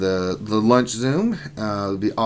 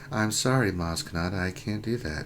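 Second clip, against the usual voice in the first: robotic refusal; 5 semitones lower, 10 dB quieter than usual. Pretend. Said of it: "Alexa, would you roll".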